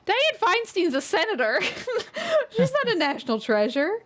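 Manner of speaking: giggling high through the words; laughing. Real, not acted. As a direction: laugh it out in disbelief at the mix-up. Laughs at her own mix-up.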